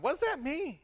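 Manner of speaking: high puzzled mock question; baffled question. Pastor to congregation, puzzled high question. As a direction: ask it high, truly stumped. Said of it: "Now I'm thirty-nine".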